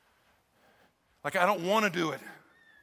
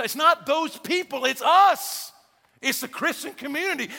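loud and higher, driving it home; preaching shout; 8 semitones higher, 5 dB louder than usual. Real, not acted. Pastor tells his congregation it's us, loud preaching. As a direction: call the church out with full force.

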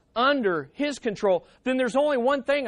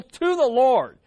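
"to the Lord" punched louder and higher; emphatic TO THE LORD; 4 semitones higher, 6 dB louder than usual. Shown as a demonstration. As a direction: land hard on "to the Lord". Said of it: "The emphasis is".

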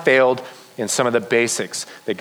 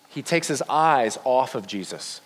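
ordinary delivery

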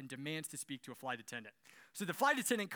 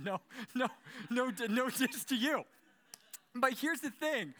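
higher, louder laughing disclaimer; 8 semitones higher, 6 dB louder than usual. Real, not acted. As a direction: laugh it off to the real Susans. Laughing apology to Susans.

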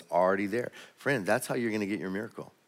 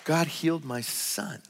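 raised, slowed, awed declaration; moved, exultant; 7 semitones higher than usual. Retold or imitated. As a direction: proclaim it like the mother overcome with joy. Reading a mother's joyful testimony.

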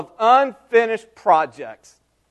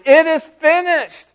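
high, raised cry, mildly shouted; imitated shout; 9 semitones higher, 4 dB louder than usual. Preacher voicing Jesus' last cry to the congregation, shouted. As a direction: proclaim it like a triumphant cry of victory. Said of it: "but he shouted it. He shouted".

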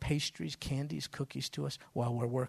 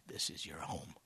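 much softer, lower and tender; tender, hushed; 9 semitones lower, 11 dB quieter than usual. Retold or imitated. A weeping store owner welcoming the preacher home, soft and low. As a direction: say it gently, almost breaking. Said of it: "and he's crying".

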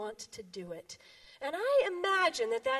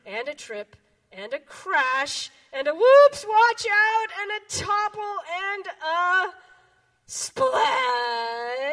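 louder, building to a crashing splash; tumbling chaos; 4 semitones higher, 8 dB louder, slower than usual. Acted. Animals tumble into the pool, rising and loud.

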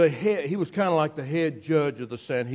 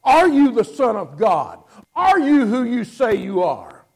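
demanding; 5 semitones higher, 7 dB louder than usual. Retold or imitated. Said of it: "is what Annas says".

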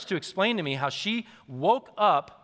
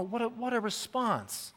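ordinary talk, nothing marked

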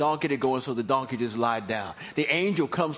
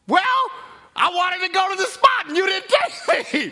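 high, loud, put-out retort; indignant rider; 8 dB louder than usual. Acted. Balaam answering his talking donkey, loud indignant high retort. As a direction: argue back without skipping a beat.

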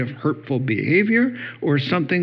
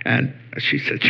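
ordinary delivery

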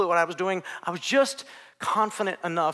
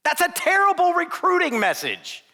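high, slow incredulous outcry; 12 semitones higher, 4 dB louder than usual. Real, not acted. Preacher mocking Jesus' recruiting pitch, high and drawn out. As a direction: throw up your hands at it.

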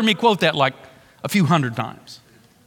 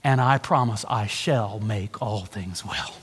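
hushed solemn promise; 9 semitones lower, 7 dB quieter than usual. Acted.

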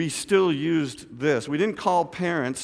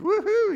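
high, playful woo-hoo; light mock cheer; crowd woo-hoo. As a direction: mimic an audience member's high whoop. Imitating an audience cheer.

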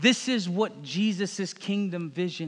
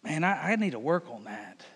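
quiet confession; 5 semitones lower, 5 dB quieter than usual. Acted. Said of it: "read it and go".

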